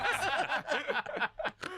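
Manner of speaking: squealing laugh, pitch shooting way up; giggle, delighted with himself; high laugh. Real, not acted. Guest laughing at his own made-up story, high squeal of laughter.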